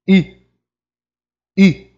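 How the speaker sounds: "I" said through lips rounded for U; "I" as a rounded French u. Shown as a demonstration. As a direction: pout for U and say "I". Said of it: "A gente faz a boca do U".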